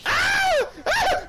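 two very high, loud shrieks; short high-pitched yelps of panic; panicked screams. Real, not acted. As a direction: scream as the zombie traps you. Trapped by a zombie, screaming.

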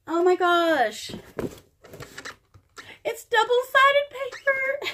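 high, loud delighted squeal; excited squeal. Real, not acted. Crafter finding double-sided paper in her gift, excited squeal. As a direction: squeal with real joy at the find.

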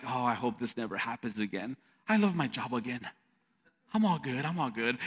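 hopeful self-talk. Retold or imitated. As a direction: talk yourself back into it quietly. Hopeful inner thought next day.

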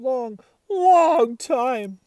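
long, slow, stretched-out whine; slowly stretched lament; drawn out. Acted. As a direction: drag each long out like a sad dog. Dog pining for owner.

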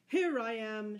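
loud, cheerful call back. A narrator's reading. Robin answers the stranger from the water, gaily shouting. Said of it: "shouted Robin gaily".

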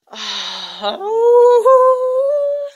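loud, high sing-song sigh; sung sigh. Real, not acted. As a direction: let out a long, high melodic sigh of exasperation. Exasperated after the story.

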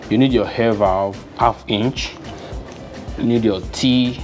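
ordinary delivery

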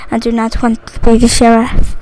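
low, soft grumble to oneself; subdued complaint. Real, not acted. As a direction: drop it low and quiet. Repeats complaint, fading into whisper.

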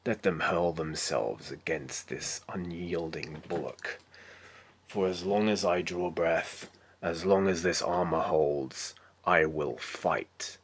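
slow, measured heroic monologue with weighted pauses; resolute knight Arthur. Acted. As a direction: play an unyielding armored warrior, deliberate and solemn. Self-tape monologue as knight Arthur.

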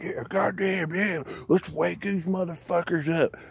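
a hint of a pumped-up conspiracy fan; mock hyped truther. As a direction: toss it off like an excited guy online. Mocking hyped conspiracy crowd.

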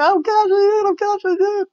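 high, loud excited yell; excited shout. Retold or imitated. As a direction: shout it out in triumph. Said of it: "She's like".

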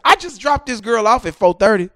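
normal talking voice

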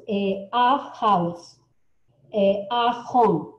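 "house" and "home" very slow and loud with a breathy h; teacher drilling the sounded h in "house". Shown as a demonstration. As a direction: drag out "a house", "a home", h clear. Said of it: "Suena la J".

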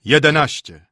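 loud booming toll; giant bell. A narrator's reading. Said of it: "rzekł olbrzymiec".

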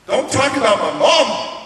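loud, high-pitched retold fury; angry outburst. Teen warning the counselor off his mom, angry. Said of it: "I'm getting angry at her".

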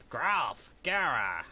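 loud, high disgusted squeal; Jimmy Fallon impression. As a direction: squeal it like a sketch character. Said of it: "You guys don't know that reference".

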